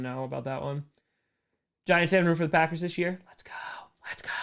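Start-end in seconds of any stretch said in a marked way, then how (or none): 3.3-4.3 fast hushed whisper; whispered hype. Real, not acted. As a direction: hiss it out like a secret cheer.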